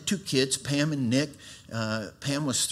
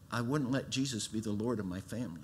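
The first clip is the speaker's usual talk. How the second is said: ordinary delivery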